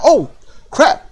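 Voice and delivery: high, startled "oh, crap"; mock surprised yelp. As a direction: play the wrestler getting hit. Voicing hit wrestler.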